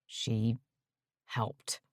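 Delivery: barely voiced, trailing into a whisper; muttered. A narrator's reading. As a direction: mumble it under your breath. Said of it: "he muttered".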